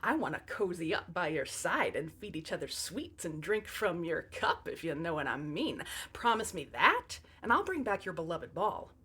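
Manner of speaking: seduce her creepily, softly; creepy seductive. Acted. Frog's creepy seductive proposal.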